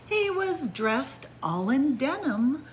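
ordinary delivery

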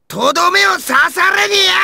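high-pitched fierce yell; taunting shout. Acted. Daring the enemy to come be finished, fierce yell. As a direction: goad them with a shout.

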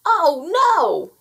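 high, loud cry of alarm; shouted. A narrator's reading. Gnome with a smoldering beard, shouting. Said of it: "he shouted".

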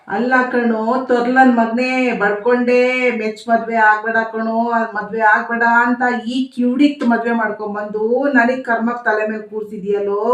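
usual speaking voice

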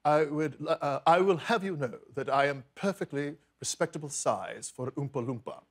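posh British actor impression; Hugh Grant. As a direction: speak with plummy British respectability. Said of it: "my Hugh Grant".

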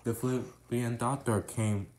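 ordinary delivery, nothing marked

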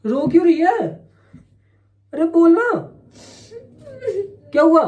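loud, high-pitched insistence; urgent questioning. Real, not acted. Man begging his crying partner to speak, urgent. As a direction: push hard for an answer.

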